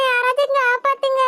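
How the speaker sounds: put-on high child voice, desperate calls for rescue; a small girl screaming for her mother from the water; tearful high-pitched pleading; little girl crying for help. Girl still calling for help.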